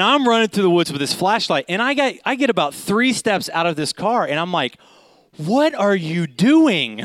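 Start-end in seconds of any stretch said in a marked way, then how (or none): 5.4-7.0 slow, higher-pitched disbelief; mock alarm. Man questioning himself three steps into a chase, mock alarm. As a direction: act out incredulous alarm, drawing the words out.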